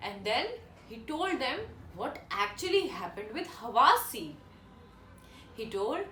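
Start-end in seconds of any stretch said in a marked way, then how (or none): none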